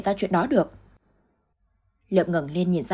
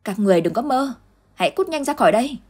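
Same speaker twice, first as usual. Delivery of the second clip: louder, rising to a high yell; desperate shout, higher and louder; 6 semitones higher, 4 dB louder than usual. Acted. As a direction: scream them out of the room in despair. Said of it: "Dung hét lên trong tuyệt vọng".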